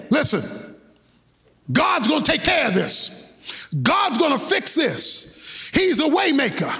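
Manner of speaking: Moses as a preacher building to shouts; Moses preaching from the pulpit. Acted. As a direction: lift the crowd like a preacher on the pulpit. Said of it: "he's standing up and saying".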